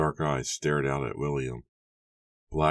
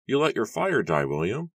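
much higher voice, rising on the name; Matthew's character voice; 9 semitones higher, 4 dB louder than usual. A narrator's reading. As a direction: remark in a high, lilting register. Matthew's high character voice, remarking.

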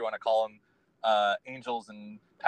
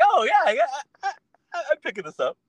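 high-pitched burst of dawning excitement; giddy mock eureka moment, easing off at the end; excited realization; 14 semitones higher, 6 dB louder, faster than usual. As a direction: act out suddenly catching on, starting loud and high. Acting out catching on to messages.

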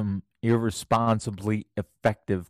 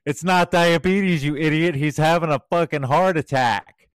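shouting over a wall at the nurses, higher and louder; retold yell; 5 semitones higher, 5 dB louder than usual. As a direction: bark it like the doctor who knows better. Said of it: "he's sitting there yelling over the quad wall".

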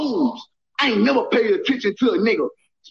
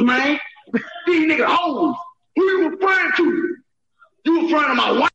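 furious shouting; 7 semitones higher than usual. Real, not acted. Ranting at the jailed rapper, furious shouts. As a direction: shout him down with real anger.

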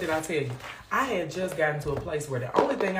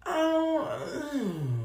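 high drawn-out "oh" sliding to a hum; high mock-startled hum; reaction noise; 11 semitones higher than usual. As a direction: reenact a squealed oh, then mm. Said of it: "I was like".

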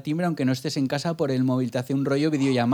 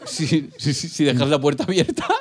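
ordinary talk

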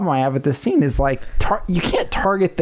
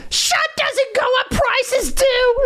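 put-on very high squeaky kid voice; little sister voice; slower than usual. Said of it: "She goes".

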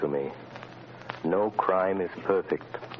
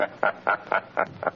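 breathless unvoiced chuckle of the unseen man; 3 semitones higher than usual. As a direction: laugh under your breath, taunting. Shadow taunts unseen in room.

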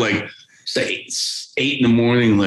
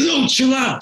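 pitched-up nervous calming; high urgent hush. Retold or imitated. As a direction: urge a friend to chill in a high excited voice. Friend calming him down.

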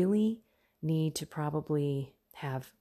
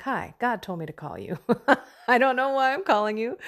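higher, louder, fast laughing quote; 6 semitones higher, 8 dB louder, faster than usual. Retold or imitated. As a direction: laugh through the awkward phone greeting. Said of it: "And I said it like this".

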